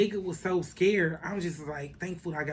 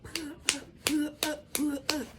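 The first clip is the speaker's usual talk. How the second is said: much higher, urgent repeated command; urgent pull up; 11 semitones higher, much slower than usual. Retold or imitated. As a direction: hurry the driver, pitch up high. Urging friend as Jeep arrives.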